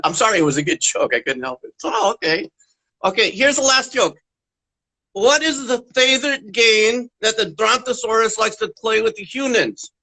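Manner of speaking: ordinary talk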